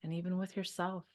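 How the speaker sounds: calm meditation voice with long pauses; guided meditation. Real, not acted. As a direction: guide them to unwind, leaving room to breathe.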